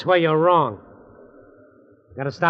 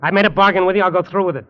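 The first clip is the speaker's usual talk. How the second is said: angry declaration; 5 semitones higher, 7 dB louder, faster than usual. Acted. Quarrel with his uncle.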